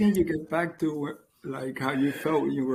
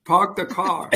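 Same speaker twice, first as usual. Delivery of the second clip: put-on loud echo of a Boston accent; put-on Boston accent, punched out; 3 semitones higher, 6 dB louder than usual. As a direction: playfully mimic Boston's dropped R's. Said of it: "can you do a good Boston accent?".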